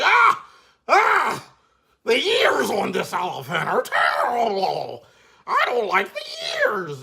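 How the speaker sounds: high, loud hammed-up shriek of disgust; horrified headmaster. Headmaster seeing the elephant's ears, hammed-up high shriek. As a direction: scream at the ugly ears. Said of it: "He's like".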